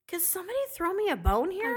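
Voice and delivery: high-pitched mock pleading; exasperated plea. Complaining about the farting arbitrators, exasperated plea. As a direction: pitch it right up in playful exasperation.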